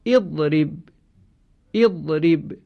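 اضرب said twice, clearly with an opening "i" vowel, "iḍrib"; model pronunciation. Shown as a demonstration. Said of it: "مانند این فعل". (تلفظ نمونهٔ اضرب، دو بار)

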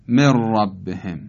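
«من ربهم» as mer-rabbehem, silent n, rolled double r; idgham. Shown as a demonstration. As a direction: drop the ن of «من ربهم» and roll a long ر. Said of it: "نون رو نمیخونیم". («من ربهم» با ادغام نون در ر مشدد)

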